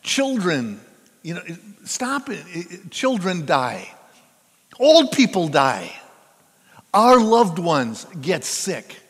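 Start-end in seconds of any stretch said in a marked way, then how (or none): 4.8-9.0 emphatic. Acted. Preacher stressing suffering.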